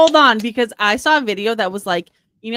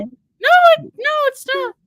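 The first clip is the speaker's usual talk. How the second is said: much higher, loud protest; squeaky denial; 7 dB louder than usual. Real, not acted. High denial.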